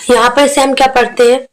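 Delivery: ordinary talk, nothing marked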